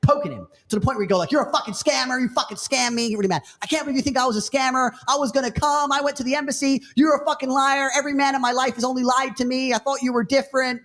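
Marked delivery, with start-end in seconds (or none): 1.3-10.8 much higher, hammed-up furious accusations; mock angry argument. Imitating the quarrel between the man and the girl, higher. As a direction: act out the blowup.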